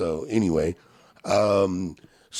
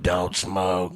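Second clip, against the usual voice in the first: lightly voiced old lady; a hint of a frail old woman lecturing. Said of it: "I sound like that old lady in an after school special".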